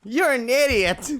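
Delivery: high loud playful yell; giggly mock insult; laughing scold. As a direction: shout it fondly. Laughing after being spun.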